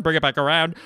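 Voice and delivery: loud relay of the captain's order; frantic movie impression. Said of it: "here's my impression of".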